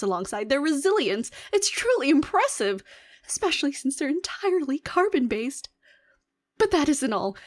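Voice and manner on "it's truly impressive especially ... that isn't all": 高い声で感心しきって; 熱っぽく、声を上げて; excited marvel. Acted. Scientist marvels at humans.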